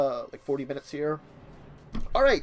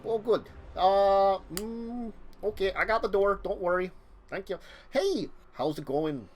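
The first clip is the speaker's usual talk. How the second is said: chatty neighbor; 4 semitones higher than usual. Acted. GM voices Ryan NPC.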